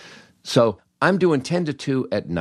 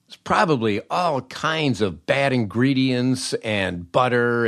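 upbeat, higher-pitched sales pitch; ad read; 4 semitones higher than usual. Acted. Host pitching Blue Apron to his listeners, upbeat ad read. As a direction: sell it hard to the listeners, like a radio spot.

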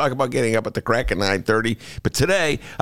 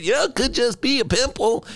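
random passerby; 10 semitones higher than usual. Retold or imitated. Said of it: "random people on the street".

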